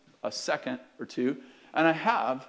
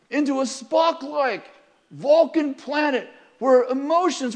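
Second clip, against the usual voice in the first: fervent pulpit proclamation; impassioned preaching; 12 semitones higher, 5 dB louder than usual. Acted. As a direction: drive each phrase home at full voice. Preaching at full force.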